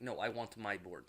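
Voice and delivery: put-on soft, low Russian accent. Whiplash correcting Hammer, in a Russian accent. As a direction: insist in a thick Russian accent. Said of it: "He's like".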